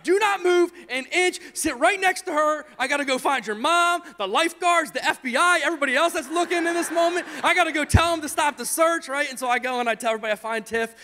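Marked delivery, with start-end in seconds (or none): 0.0-9.1 loud, high, frantic parental scolding; yelled orders. Retold or imitated. Father orders daughter to stay put, frantic yelling. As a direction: lay down the law to a child. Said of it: "I'm sorry for yelling".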